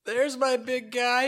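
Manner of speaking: high, slow, proud greeting; excited dad. Retold or imitated. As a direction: beam at your son. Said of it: "And then".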